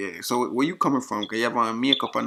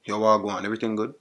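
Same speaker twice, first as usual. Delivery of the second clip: thick Jamaican patois; Jamaican accent; 5 dB louder, faster than usual. Acted. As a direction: greet in heavy Jamaican patois. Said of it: "used my Jamaican accent".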